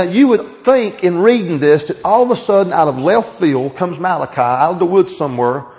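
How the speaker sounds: normal talking voice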